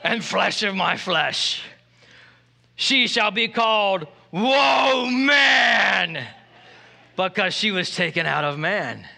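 put-on grand English accent, words drawn out. Adam reciting his poem, theatrical English accent. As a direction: declaim it in a slow English accent, swelling on "Whoa Man". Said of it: "doing this with the English accent".

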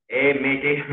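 loud, high Australian greeting; Australian accent. Shown as a demonstration. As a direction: hail a mate in an Australian accent.

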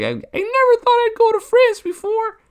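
put-on squeaky excited child, very high and loud; little kid voice. Three-year-old Billy thrilled about new family, squeaky high. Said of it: "He's like".

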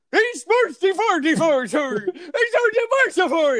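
jolly Muppet chef voice bursting through the door; Swedish chef. Acted. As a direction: ham up the Muppet chef's babble. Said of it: "It is the Swedish chef".

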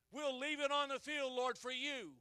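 slow, loud praying; fervent prayer. Acted. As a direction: pray it out with fire.

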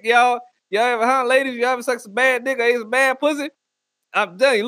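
ordinary talk

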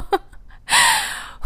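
breathy high laugh through tears; a light laugh to hold back tears; teary high laugh. Real, not acted. Host laughing at her own tears, teary high laugh.

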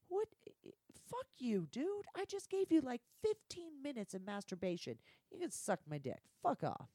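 retold angry outburst, pitch leaping high; pissed off. Performer quitting on a pushy director, pissed off. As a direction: tell off the director, indignant and fed up. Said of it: "And I got pissed".

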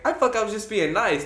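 high-pitched defensive retort; acted protest. Teen to seatmates about introducing himself, high-pitched protest. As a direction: re-enact a flustered self-defence.

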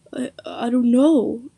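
slow, stuttering, shaken reply. A narrator's reading. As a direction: stammer it out in shock. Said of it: "Liam stuttered".

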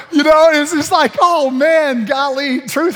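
high, loud laughter through the words; laughing. Real, not acted. Laughing at his own joke about the kid, high and loud. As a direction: crack up while trying to speak.

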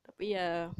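normal talking voice

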